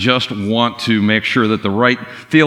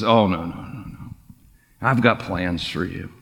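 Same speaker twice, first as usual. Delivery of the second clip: gentle reassurance; 4 semitones lower, 6 dB quieter than usual. Retold or imitated. Jesus reassuring kneeling Peter.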